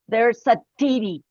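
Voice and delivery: TIRI with a t instead of th; mispronounced THEORY. Retold or imitated. Showing the class how the teacher pronounced it, mispronounced "tiri". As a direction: demonstrate "tiri" for theory, no th sound. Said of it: "he pronounced".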